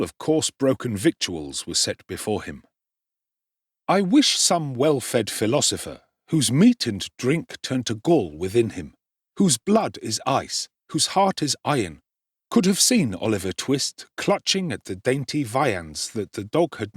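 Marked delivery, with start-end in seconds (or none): none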